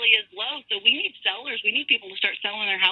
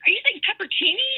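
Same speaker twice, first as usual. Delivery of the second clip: retold baffled question, much higher pitch; bingung; 10 semitones higher than usual. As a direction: imitate yourself asking in high-pitched confusion. Retelling her baffled question to agent.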